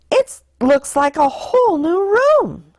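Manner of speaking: put-on high, amazed child voice; delighted little sister. A narrator's reading. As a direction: marvel at the clean room. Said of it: "said sister".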